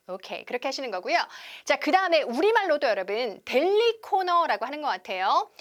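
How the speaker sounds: ordinary talk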